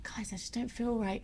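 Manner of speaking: weak sick whisper. Retold or imitated. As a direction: whisper it, feeling ill. Sick on the tour bus.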